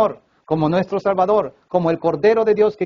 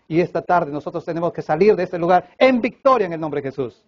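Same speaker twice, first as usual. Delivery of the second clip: ordinary talk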